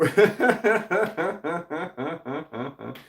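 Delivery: long high burst of laughter. Real, not acted. Streamer reading a held-for-review message, laughing out loud. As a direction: crack up laughing.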